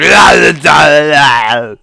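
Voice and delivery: loud shouting laugh; big cackling laugh at the clip; burst of laughter. Real, not acted. Laughing loudly at the funny face.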